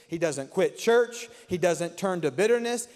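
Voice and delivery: normal talking voice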